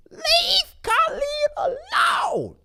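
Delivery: high, slow, wailing plea; mock fan plea. A fan defending Colleen in the chat, wailing plea. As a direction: wail it like a desperate fan. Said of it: "the real Cynthia says".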